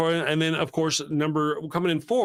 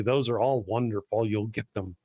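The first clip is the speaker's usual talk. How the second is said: ordinary talk, nothing marked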